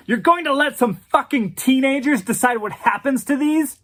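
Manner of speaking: high, loud outraged yell; a fuming dealer yelling at the heirs; angry yell. Acted. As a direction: spit it at them in disbelief. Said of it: "Patrick yelled".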